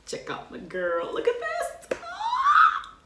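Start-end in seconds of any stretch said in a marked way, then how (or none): 1.1-2.9 very high shriek of delight; excited scream. Real, not acted. Fan screaming with joy over her new album. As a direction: scream it like a fangirl.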